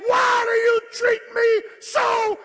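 loud, high pleading wail, slowed right down; anguished cry to God. Retold or imitated. Preacher voicing his cry to God.

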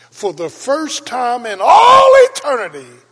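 sudden high, loud preacher's cry; preaching shout. Acted. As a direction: burst out at full force from the pulpit.

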